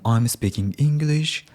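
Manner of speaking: uptalk with "English" lifted at the end; rising intonation. Shown as a demonstration. Showing how unconfident learners seem to be asking, rising intonation. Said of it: "goes up".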